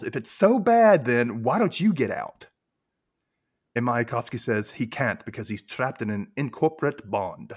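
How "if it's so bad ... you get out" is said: mock yell. Recapper acts out Penny yelling at Mayakovsky, mock yell. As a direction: burst in loud and high, then ease off. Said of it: "so Penny yells".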